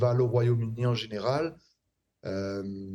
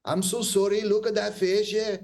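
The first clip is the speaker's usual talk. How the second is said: Scottish accent pitched high; caricature Scottish accent; 8 semitones higher than usual. Shown as a demonstration. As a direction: ham up a Scottish accent for laughs. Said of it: "je caricature". (caricature d'accent écossais, voix haute)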